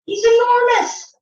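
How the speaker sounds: excited parent marvelling at the picture; amazed exclaim. Real, not acted. Exclaims to child about the beast.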